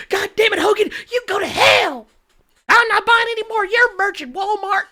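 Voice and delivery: very high, hammed-up indignation; outraged female fan. Imagined woman seeing the shirt and disowning Hogan, mock outrage. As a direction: wail it in a high mock-angry voice. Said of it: "that was probably her".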